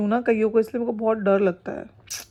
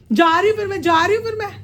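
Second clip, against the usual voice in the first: teasing call; 8 semitones higher, 5 dB louder than usual. Acted. Teasing the dog about leaving.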